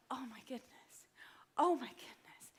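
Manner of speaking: imitating overwhelmed, breathy disbelief; astonished whisper. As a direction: whisper it as if stunned. Retold woman overcome on recognizing her.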